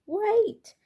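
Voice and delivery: high loud plea; calling out. A narrator's reading. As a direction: shout after the cart, desperate. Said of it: "called Mister Brown".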